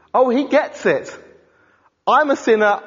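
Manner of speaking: ordinary talk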